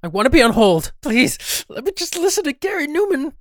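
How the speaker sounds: high, desperate pleading; pleading whine. Acted. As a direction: beg like an addict. Begging to stay on hold.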